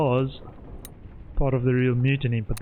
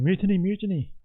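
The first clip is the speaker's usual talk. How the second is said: imitated shout; 8 semitones higher than usual. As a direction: imitate someone crying out mutiny, high-pitched. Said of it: "think was shouting".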